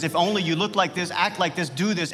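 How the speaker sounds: full-force preaching. Acted. Preacher voicing the snake's lies to the congregation, impassioned and loud. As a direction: preach with mounting intensity.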